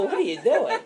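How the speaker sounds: high, laughing what-are-you-doing; incredulous laughing question; laughing disbelief. Retold or imitated. Boss retelling his reaction to Perry, high and laughing. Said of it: "I go".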